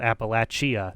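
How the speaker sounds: Appalachia with an extra syllable, LATCH-ee-uh; wrong pronunciation. Shown as a demonstration. Streamer ruling out one variant, Appalachia mispronounced "ap-uh-LATCH-ee-uh". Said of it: "That's the only one that's wrong".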